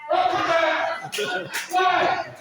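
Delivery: high, slow, drawn-out protest; indignant wife. Acted. Preacher voicing a wife protesting to her husband, high and indignant.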